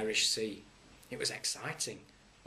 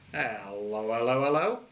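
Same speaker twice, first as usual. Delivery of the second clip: lightly voiced bobby, rising and louder; a cheery copper's hello, hello, hello; mock policeman greeting; 6 dB louder than usual. A narrator's reading. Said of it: "he said".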